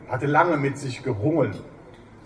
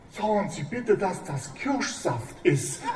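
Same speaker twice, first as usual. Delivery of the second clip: breathed; 6 semitones higher, 5 dB quieter than usual. Acted. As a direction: barely breathe the words out. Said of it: "hauchte Manni".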